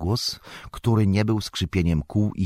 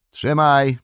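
high, loud order; urgent call; 9 semitones higher, 6 dB louder than usual. Acted. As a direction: bark it as you toss the reins. Farmer hands reins urgently. (głośny, pilny rozkaz)

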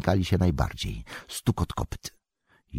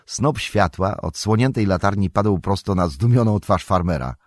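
plain reading voice

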